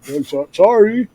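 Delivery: high, slow, halting "sorry"; mock sheepish apology. Caught taking another band's beer, mock sheepish apology. Retold apology to robbed bands.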